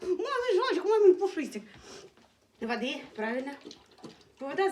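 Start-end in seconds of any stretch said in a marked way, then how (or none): 0.0-1.6 high, loud gushing pet talk; affectionate cooing. Real, not acted. Mama smothering her fluffy bunny in love, cooing. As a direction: squeal endearments with a laugh in it. Gushing over the dog with endearments.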